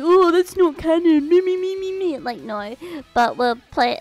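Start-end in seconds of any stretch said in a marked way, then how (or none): 0.0-2.2 loud, high, whiny mock voice; mock a self-centred lore snob; mocking whiny fan. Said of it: "I hate people like".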